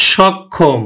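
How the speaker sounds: সক্ষম as shawkkhom; open vowel. Shown as a demonstration. Teacher demonstrating the natural pronunciation, open shawkkhom. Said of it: "স্বাভাবিক উচ্চারণ হবে".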